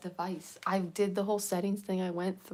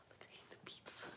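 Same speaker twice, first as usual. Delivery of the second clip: whispering; 19 dB quieter, much faster than usual. Shown as a demonstration. Repeated line, whispered take.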